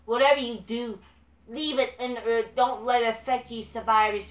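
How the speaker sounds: high, loud, mocking sing-song; mocking quote. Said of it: "she was telling them".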